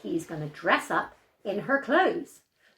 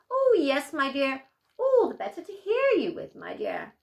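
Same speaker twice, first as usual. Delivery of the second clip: wolf playing grandma; 6 semitones higher than usual.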